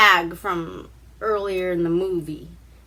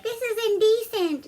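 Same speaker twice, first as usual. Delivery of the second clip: higher, prim protest, lightly voiced; prim young woman; 13 semitones higher than usual. Girl in the bath protests to the prince, higher prim voice. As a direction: play the modest girl objecting. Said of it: "she's like".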